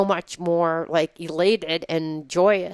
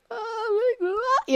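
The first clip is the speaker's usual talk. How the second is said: high, slow mock cry; hammed-up tearful whimper; mock wail; much slower than usual. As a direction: draw it out as a high comic wail. Acting out her reaction to empty room.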